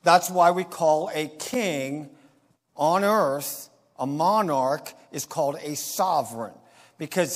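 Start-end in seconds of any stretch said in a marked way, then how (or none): none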